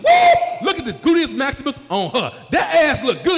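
loud, high whoop of admiration; excited catcall. Retold or imitated. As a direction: holler at the sight like a teen. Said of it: "I said".

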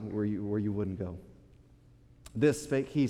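low, soft, measured King James reading; scripture reading. Acted. Reads scripture aloud.

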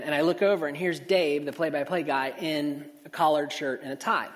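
usual speaking voice

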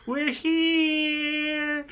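put-on very high tiny child voice; chirpy child cheer; cute platelets. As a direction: squeak it like a little kid. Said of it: "they're like".

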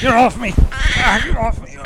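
loud, high panicked yelling; panicked shout. Acted. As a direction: scream to shake it off. Attacked by creature.

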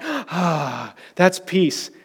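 hushed mock serenity; mock soft sigh. Shown as a demonstration. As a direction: parody a limp, wispy idea of peace. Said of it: "super timid and soft".

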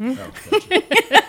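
slow, high laughing realization. Real, not acted. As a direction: laugh it out, drawn out.

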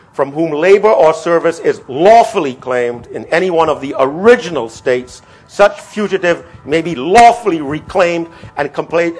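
"lawfully" and "original states" punched loudly; emphatic reading. Shown as a demonstration. Lecturer rereading the proviso to his class, key words stressed. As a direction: read the clause out, hammering "lawfully" each time. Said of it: "to repeat what I want to emphasize".

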